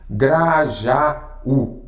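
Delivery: "Grajaú" sounded out in three drawn-out syllables; split into syllables. Shown as a demonstration. Teacher dividing a word to show a hiatus, syllable by syllable. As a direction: stretch and separate each syllable of "Grajaú". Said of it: "separação em sílabas".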